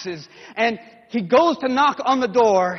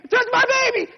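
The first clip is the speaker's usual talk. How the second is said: excited mother; 14 semitones higher, 5 dB louder than usual. Acted. Mothers bring babies.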